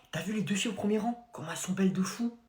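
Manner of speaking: hushed, voiceless whisper; conspiratorial classroom whisper; whispered aside. Acted. Pupil confides to classmate.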